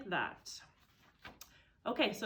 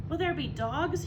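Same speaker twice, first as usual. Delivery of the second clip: scared little fox; 7 semitones higher, 4 dB louder than usual. Acted. Small fox voiced in story.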